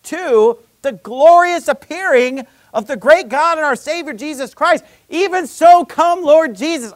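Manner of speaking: loud, high, slowed-down rapturous exclamation; excited outburst. Retold or imitated. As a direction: cry it out with joyful wonder. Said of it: "something to get even more excited about".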